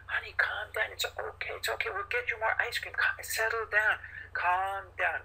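put-on mother shushing an upset toddler; soothing parent. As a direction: hush the child sweetly. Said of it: "being more calm ourselves".

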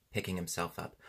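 ordinary delivery

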